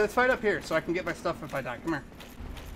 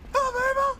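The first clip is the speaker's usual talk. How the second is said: much higher, pleading squeal; whiny plea. Real, not acted. Begging teammate Ma for help in a high whine.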